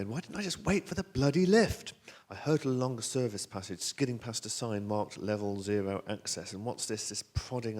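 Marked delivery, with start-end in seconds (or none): 0.0-1.9 high, loud self-reproach; frustrated outburst, voice raised; exasperated. Acted. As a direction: curse your own stupidity. Self-reproach at locked door.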